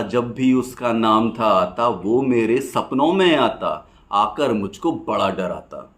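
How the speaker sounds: rhythmic rhymed verse, pausing after each line; poem recitation. Acted. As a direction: recite it as lilting stage poetry.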